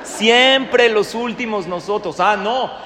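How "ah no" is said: loud, higher mocking jab; loud sarcastic GPS scolding; sarcastic reproach. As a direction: needle him about his parents. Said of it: "Qué te dice el Waze".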